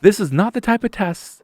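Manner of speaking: high, loud mock objection; alarmed protest. Tester correcting the panicked manager, high loud alarmed protest. Protesting at her bomb talk.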